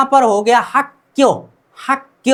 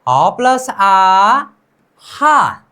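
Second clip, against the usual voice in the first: spelled out slowly; 3 semitones lower, much slower than usual. Shown as a demonstration. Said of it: "नीचे यहाँ पर क्या है?".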